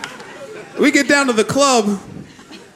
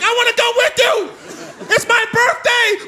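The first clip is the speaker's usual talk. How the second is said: high, pleading mock shout; cousin voice; 13 semitones higher than usual. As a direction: beg like the overexcited cousin, voice up high.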